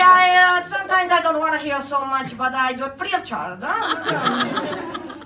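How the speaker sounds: put-on cocky kid spy. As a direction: announce yourself like a tiny proud secret agent. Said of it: "I'd be like".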